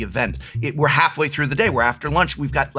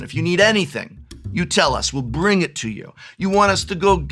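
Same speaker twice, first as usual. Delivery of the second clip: put-on poor Italian accent, pitched up; attempted Italian accent; 7 semitones higher, 3 dB louder than usual. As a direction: try an Italian accent, badly. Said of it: "I can't do an Italian accent".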